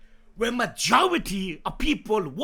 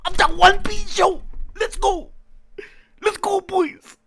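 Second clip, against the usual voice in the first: hyped shout; 8 semitones higher, 6 dB louder than usual. Real, not acted. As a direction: shout it out, pumped. Hyped reaction stream opening.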